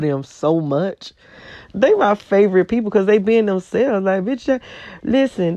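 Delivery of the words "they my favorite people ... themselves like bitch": normal talking voice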